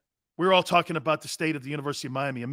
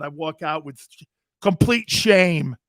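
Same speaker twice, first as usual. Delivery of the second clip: normal talking voice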